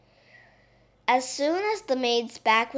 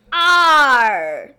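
loud growly pirate shout; pirate cry; 11 dB louder than usual. Acted. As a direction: bellow it like a pirate. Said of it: "cried the little princess".

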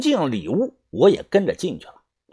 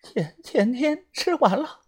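soft, high falsetto through pain; thin out into a weak, halting head voice; quiet put-on high voice, faltering; 5 semitones higher, 7 dB quieter than usual. A narrator's reading. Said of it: "用假音说道".

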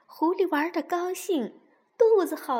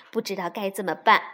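ordinary delivery

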